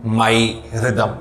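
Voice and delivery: माई and रिदम spoken slowly, each word set apart; slow word demo. Shown as a demonstration. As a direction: read out माई, रिदम carefully for students. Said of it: "देखिए, मैंने क्या लिखा है".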